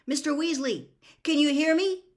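high, carrying question; calling through boards. A narrator's reading. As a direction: call out to someone behind a wall. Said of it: "called through the boards".

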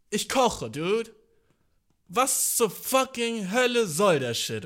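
ordinary delivery

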